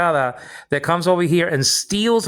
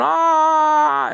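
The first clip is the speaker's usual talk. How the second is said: imitated open-mouthed ah; 12 semitones higher, 4 dB louder than usual. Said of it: "when she's going".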